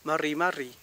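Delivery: MARRI MARRI with rolled Spanish rr; wrong double r. Shown as a demonstration. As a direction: trill both r sounds in MARRI MARRI. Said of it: "No digo".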